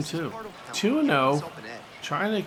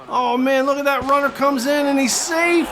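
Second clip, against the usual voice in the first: excited shout; 9 semitones higher, 6 dB louder than usual. Real, not acted. Runner scoring, live call.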